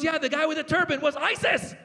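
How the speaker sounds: put-on high-pitched kid in a panic; freaking out. Six-year-old explaining who he saw in the bathroom, freaking out. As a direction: insist on it in hammed-up, high alarm. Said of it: "he starts freaking out".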